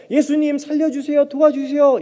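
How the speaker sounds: high calling plea, not shouted; acted cry for help, held back; restrained plea. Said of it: "이런 정도가 아니라".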